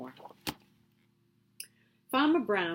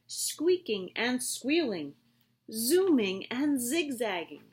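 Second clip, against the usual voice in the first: excited; 6 semitones higher than usual. A narrator's reading. Recess bursts into noise.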